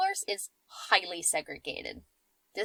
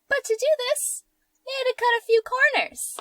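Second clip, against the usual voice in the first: dramatic high voice; 5 dB louder than usual. Acted. Ominous dramatic build.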